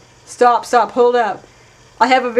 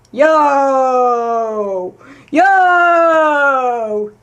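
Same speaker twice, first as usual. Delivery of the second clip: calling out; 5 dB louder than usual. Real, not acted. Player calling out to the shouting voices, long and loud. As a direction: hail the voices from across the room.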